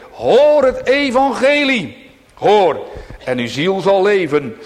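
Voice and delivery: high, loud gospel call; full-force pleading sermon; fervent preaching. Real, not acted. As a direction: thunder the invitation from the pulpit.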